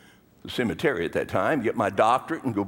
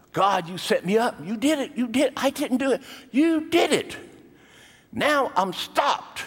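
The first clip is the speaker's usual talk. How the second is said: furious prayer at God; 11 semitones higher than usual. Retold or imitated. Pastor accusing God after India cancelled, furious rising rant. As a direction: hurl blame at God, pitch rising higher. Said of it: "I was furious".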